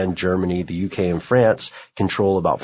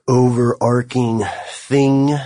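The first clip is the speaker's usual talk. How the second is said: ordinary talk, nothing marked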